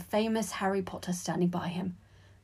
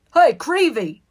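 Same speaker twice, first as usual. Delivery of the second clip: loud, raised hail; calling out; 8 semitones higher, 12 dB louder, slower than usual. A narrator's reading. Sixth year hailing the younger boy, loud shout. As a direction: yell it across the table. Older student calls out crossly.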